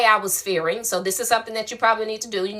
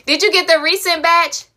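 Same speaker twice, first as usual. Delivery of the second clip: excited; 9 semitones higher, 6 dB louder than usual. Real, not acted. Excited greeting of viewer.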